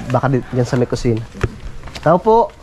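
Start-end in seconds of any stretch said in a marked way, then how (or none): 2.0-2.5 high call toward the house; calling from far away. Real, not acted. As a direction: call out to someone inside. (pagtawag mula sa malayo)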